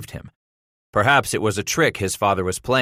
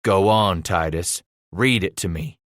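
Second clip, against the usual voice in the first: ordinary delivery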